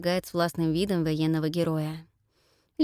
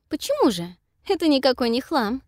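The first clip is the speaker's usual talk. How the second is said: mocking; 7 semitones higher, 4 dB louder than usual. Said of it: "насмешливо возражаю я".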